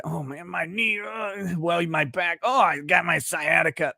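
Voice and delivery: a hint of a grumbling old man listing his pains; mock old-timer complaining about his knee and back. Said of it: "when old men get together".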